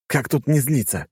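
irritable retort, pitch lifted; snappish. A narrator's reading. Hero answering Lora's warning about his anger, snappish. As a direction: snap back with mild anger. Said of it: "огрызнулся я".

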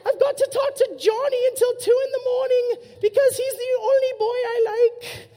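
put-on very high, loud, laughing British girl accent; shrill British girl. Pastor mocking girls' complaints, shrieking giggly British accent. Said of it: "with a British accent".

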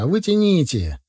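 mildly acted shout. A narrator's reading. Narrator orders the others to pull, mild shout. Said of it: "закричал я".

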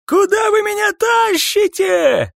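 high, stretched-out shriek of protest; shrill screaming. A narrator's reading. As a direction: scream while being dragged away. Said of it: "доносилось с визгливым эхом". (визгливый крик протеста)

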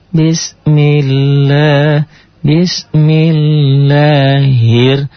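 "bismillah, bismillahir" slow and smooth; softened recitation. Shown as a demonstration. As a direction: glide through it, gentler.

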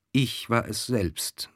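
slow, weighty "ich war es selbst"; emphatic. A narrator's reading. The count confessing the killing, slow and emphatic "ich war es selbst". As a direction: draw out every word of "ich war es selbst". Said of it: "sprach Miossin mit Nachdruck".

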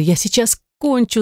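high, pleading whimper. A narrator's reading. Heroine warns her lover, whimpering. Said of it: "захныкала я".

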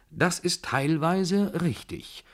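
high, slow and self-satisfied; smug high remark, slowly savoured. A narrator's reading. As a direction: drawl it out like a smug Frenchman. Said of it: "bemerkte der Franzose selbstgefällig".

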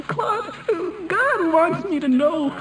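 normal talking voice

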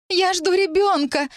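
высоко, медленно, с отчаянием; выкрикни признание, растягивая слова; истеричное признание. A narrator's reading. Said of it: "затряслась в подступающей истерике". (отчаянный высокий возглас)

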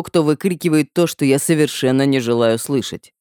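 usual speaking voice

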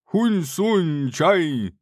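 high, slow fake-Chinese sing-song; mock Chinese gibberish. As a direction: ham up a fake accent.

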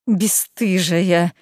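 drawn-out, spiteful accusation; venomous outrage. A narrator's reading. Мыриня spits an insult at Лиза, venomous. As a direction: stretch the word out with venom. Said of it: "исходила слюной Мыриня".

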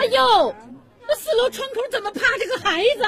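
high, rushed cry of alarm. Acted. Neighbour spots a child at the fourth-floor window, alarmed. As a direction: gasp it out fast and high in shock.

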